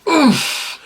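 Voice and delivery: a sharp oof jumps up high; a hammed-up oof way up high; mock impact. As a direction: sell the explosion with one sound. Describing explosive action scene.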